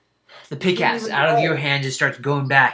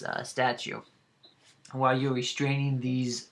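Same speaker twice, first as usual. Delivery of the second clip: ordinary delivery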